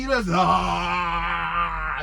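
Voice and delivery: words dragged very slowly into a yawn. Shown as a demonstration. Telling viewers how good yawning feels, slow yawn. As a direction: stretch it out like a satisfying yawn. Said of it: "You ever bust out a big yawn".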